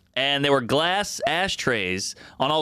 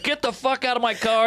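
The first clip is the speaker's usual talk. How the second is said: mock angry order; 7 semitones higher than usual. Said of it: "He's so fucking angry".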